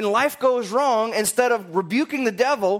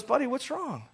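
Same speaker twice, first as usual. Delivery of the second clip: gentle rabbi; 3 semitones higher, 8 dB quieter than usual. Acted. Rabbi comforts crying Satan.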